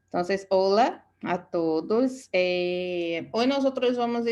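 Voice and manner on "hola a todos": usual speaking voice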